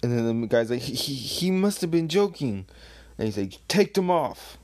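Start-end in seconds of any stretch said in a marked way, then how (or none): 0.8-2.7 higher, stammering disbelief; lightly hammed-up nervous underling; flustered stammer. Flustered guard stammering in story. 3.7-4.6 louder, higher command; lightly acted impatient order; sharp order. Order given in story.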